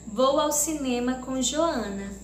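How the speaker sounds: normal talking voice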